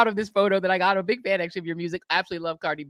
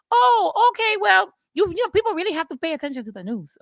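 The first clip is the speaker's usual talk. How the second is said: very high, louder Cardi impression, faster; imitating [name]; 12 semitones higher, 4 dB louder than usual. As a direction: pitch way up and rattle it off like Cardi. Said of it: "She's literally like".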